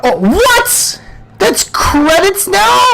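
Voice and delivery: high, stretched questioning exclamation; surprised disbelief. Real, not acted. Gamer finds unexpected credits, high surprised question. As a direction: ask it high, drawn out in disbelief.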